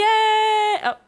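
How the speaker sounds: high loud thrilled oh; overwhelmed with joy; excited. Real, not acted. Catching the crimson fish.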